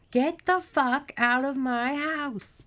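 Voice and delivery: slow, high yell, word by word; furious shout. A narrator's reading. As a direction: draw out every word of a raging order. Margaret roars back at monster.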